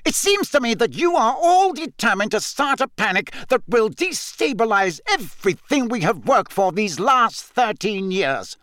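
high, heated accusation; indignant outburst, slowing at the end; angry. A narrator's reading. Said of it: "now matching her anger".

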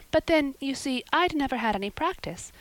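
put-on high, excusing child's voice; apologetic. A narrator's reading. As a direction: explain yourself, a little sheepishly. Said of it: "said Anne apologetically".

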